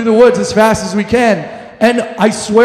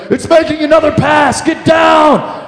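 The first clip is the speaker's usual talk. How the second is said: high urgent yell, duck now; panicked shout to get down; shouting warning; 7 semitones higher than usual. Retold or imitated. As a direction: yell over the chopper noise. Yelling warnings during chase.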